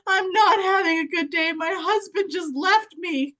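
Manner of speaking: imitating a crying customer. Customer tells the Scentsy seller her husband left, mock sobbing. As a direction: play the weepy customer, half-mocking. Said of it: "And she started crying".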